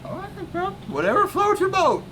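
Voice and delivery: high-pitched playful falsetto; teasing, way up high; put-on girly voice. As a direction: squeak it out in a mock-girly falsetto. Teasing reply about wanting a wig.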